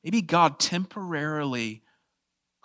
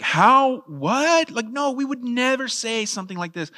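high, baffled disbelief; incredulous; 9 semitones higher than usual. Real, not acted. Preacher rejecting a question nobody asks mourners, incredulous.

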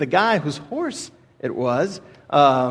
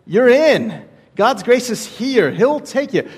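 emphatic preaching; 7 semitones higher, 3 dB louder, slower than usual. Real, not acted.

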